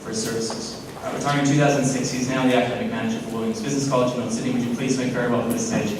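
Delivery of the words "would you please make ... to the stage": ordinary delivery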